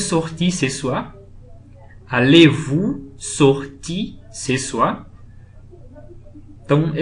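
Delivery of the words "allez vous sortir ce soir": very slow, phrase by phrase with pauses; drawn-out teaching pace, each chunk lifted; slow model repetition. Shown as a demonstration. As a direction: break it up for learners, "Allez-vous", "sortir", "ce soir". Teacher repeats example slowly for learners.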